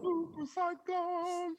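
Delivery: soft, high, stretched-out movie title; high drawn-out echo. Pretend. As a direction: float it up high and slow. Echoes movie title as drawn-out effect.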